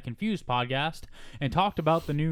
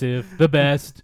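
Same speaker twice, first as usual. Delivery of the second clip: boastful Trump catchphrase; 8 dB louder than usual. Pretend. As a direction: brag it like Trump.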